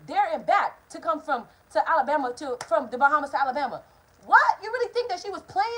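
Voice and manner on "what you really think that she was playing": incredulous shout. Real, not acted. Exclaiming at auntie's seriousness.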